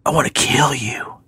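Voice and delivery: eerie whispered threat down the phone; creepy whisper. Retold or imitated. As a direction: whisper it like a ghost girl on the line. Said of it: "girl whispering into a phone".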